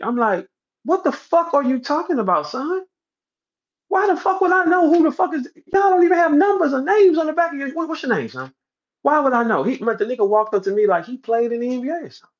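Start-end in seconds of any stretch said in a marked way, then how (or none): 0.8-9.6 very high-pitched, worked-up retold rant; indignant disbelief. Speaker retelling how he told off a rookie, indignant. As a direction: fire incredulous questions, voice way up high. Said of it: "my face turned up".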